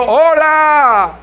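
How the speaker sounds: high, drawn-out call into the distance. Retold or imitated. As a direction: shout hello across a chasm, stretching the vowels. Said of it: "estás gritando".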